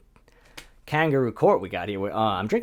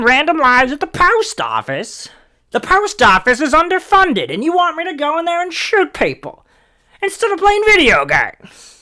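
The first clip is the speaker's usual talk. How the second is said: hysterical high, loud mock rant; shrieking indignant gamer, far above normal pitch; whiny gamer; 8 dB louder than usual. Comic as gamer protesting to Joe Rogan, worked-up whine.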